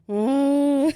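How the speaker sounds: loud drawn-out mock moan; imitated moan. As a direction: groan loudly as if asleep. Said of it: "moaning, and he's like".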